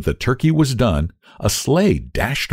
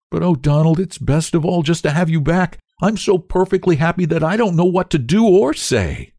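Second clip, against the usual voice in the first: overjoyed; 9 semitones higher than usual. A narrator's reading. Overjoyed reunion with brother.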